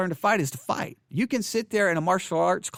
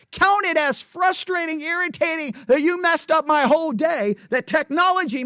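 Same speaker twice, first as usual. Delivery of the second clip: heated sarcastic rant; 8 semitones higher than usual. Real, not acted. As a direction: spit out the irritation at the day. Venting mock counting frustration.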